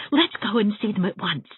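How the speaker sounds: begging to go right now; imploring. A narrator's reading. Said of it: "I implored Missus Lynde".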